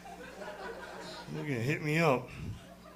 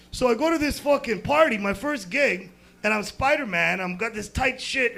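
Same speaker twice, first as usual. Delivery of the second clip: normal talking voice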